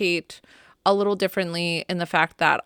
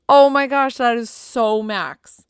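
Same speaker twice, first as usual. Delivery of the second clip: higher and much louder gasp of wonder; amazed exclaim; 4 semitones higher, 7 dB louder than usual. Retold or imitated. Wife sees a heart cloud and cries out in awe to her husband. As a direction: burst out in amazement, then slow down on the name.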